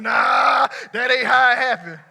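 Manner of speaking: loud, punchy preacher declaration; emphatic shout. Real, not acted. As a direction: shout it down hard.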